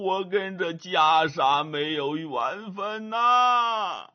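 put-on slow, tearful old monk voice; old monk sobbing, slow and snuffling; crying old monk. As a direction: sob out each word through a runny nose. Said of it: "一边哭一边流着鼻涕".

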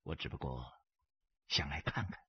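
low, soft, unbothered; cool understatement; quiet calm reply. A narrator's reading. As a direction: answer the threat softly, deep in pitch. Stranger answers threat calmly.

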